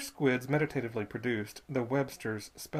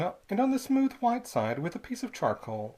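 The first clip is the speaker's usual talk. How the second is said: ordinary delivery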